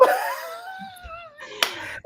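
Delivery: high squealing laugh. Real, not acted. Friend amused by the recorded mantra idea, shrieking laughter. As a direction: crack up helplessly.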